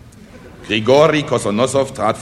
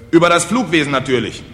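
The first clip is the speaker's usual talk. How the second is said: loud, high-pitched indignant explanation; 6 semitones higher, 4 dB louder than usual. Acted. Speaker corrects the puzzled peasants, indignant. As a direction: explain it as if affronted. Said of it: "erwiderte Kosonossow empört".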